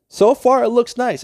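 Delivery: happy builder admiring his stadium; pleased burst. Real, not acted. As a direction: show off the build proudly.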